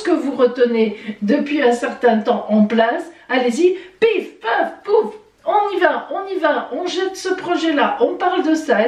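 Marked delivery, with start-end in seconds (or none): 3.3-8.9 high-pitched, playful momentum; entrain joué. Acted. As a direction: rattle off a lively go-ahead, voice up high. Mimes launching projects one after another. (entrain enjoué, voix haut perchée)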